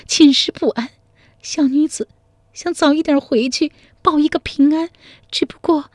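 put-on a homesick girl holding back sobs; crying. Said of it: "赵金娘哭着说".

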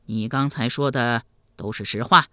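low, grave questioning; deep, earnest elder's voice; serious. A narrator's reading. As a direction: press him as a sober ruler. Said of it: "一脸严肃地问道".

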